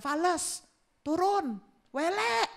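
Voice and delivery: high, scornful jeer; mocking critic. People jeering at a bad singer, high and scornful. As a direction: sneer at the singer. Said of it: "Orang bilang".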